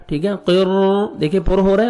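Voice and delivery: ordinary delivery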